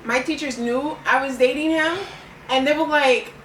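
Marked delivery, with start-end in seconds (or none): none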